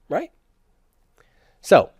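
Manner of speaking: ordinary talk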